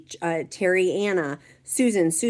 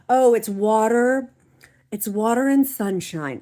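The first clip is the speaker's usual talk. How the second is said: mock fake-modest beauty; 4 semitones higher, 5 dB louder than usual. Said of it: "some people are like".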